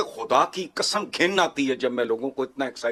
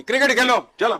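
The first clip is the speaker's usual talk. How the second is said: high, loud scornful jab; scornful outburst; 7 semitones higher, 5 dB louder, faster than usual. Real, not acted. Guest mocking a nation obsessed with cricket, scornful outburst. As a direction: throw it out as a sudden loud taunt. Scornful rant telling them to play.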